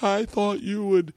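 put-on high, slow child's whine; dad imitating his daughter's tearful whine; imitating crying daughter. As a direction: mimic a tearful little girl. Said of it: "She's like".

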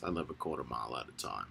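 very deep, low rumbling voice; Vin Diesel. Shown as a demonstration. Showing chat his deep Vin Diesel voice, very low. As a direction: drop the voice way down, fast and gravelly-free. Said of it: "I sound like Vin Diesel when I talk deep like this".

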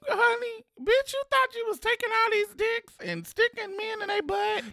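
high, sassy mock nurse voice. Friend mocking the rude HIV nurse, high sassy voice.

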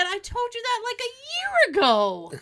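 high, incredulous retort, dropping at the end; retold exasperated comeback; mock exasperation. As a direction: protest it to your forgetful mother. Retells exasperated reply to his mom.

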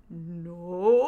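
long, drawn-out mock "no", loud; hammed-up slow-mo protest; slow-motion cry. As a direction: stretch the vowel like a slow-motion film moment. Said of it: "It all feels so slow-mo".

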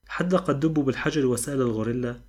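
plain reading voice

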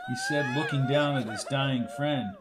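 usual speaking voice

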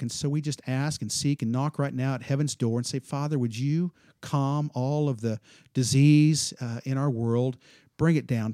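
loud, rising pastoral prayer voice; fervent prayer. Acted. Pastor closing the meeting in prayer for his church, fervent and loud. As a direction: pray over the church with mounting fervor.

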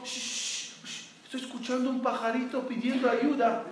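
whispered shh, then softly asking for quiet; hushed whisper. Retold or imitated. Friend on a noisy street shushing his companion, in a whisper. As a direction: hush your friend so you can hear the tiny bird.

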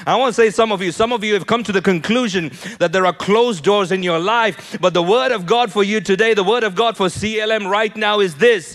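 loud and high, rising to a shout; climactic preaching. Acted. Pastor declaring God's word to the church, shouting. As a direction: proclaim it to the rafters.

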